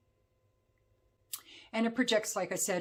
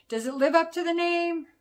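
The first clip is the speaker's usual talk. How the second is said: raised, louder teasing question; mock-dramatic question; 10 semitones higher, 8 dB louder than usual. Reviewer asking viewers about the perfume's wicked name, mock-dramatic. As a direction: pose it high and loud, like a big reveal.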